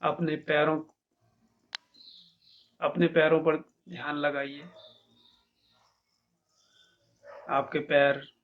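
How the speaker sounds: measured meditation guide, long pauses between instructions; guided meditation. Acted. Teacher leading listeners to feel each body part, calm meditation guidance.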